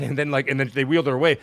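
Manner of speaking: ordinary talk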